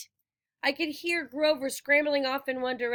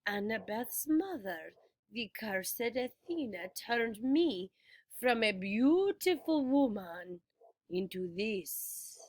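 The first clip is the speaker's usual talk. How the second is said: quiet bitter lament; 2 semitones lower, 5 dB quieter, slower than usual. A narrator's reading. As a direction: murmur the old grievance. Medusa's tale of her curse.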